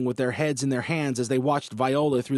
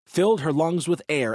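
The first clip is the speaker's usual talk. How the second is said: plain reading voice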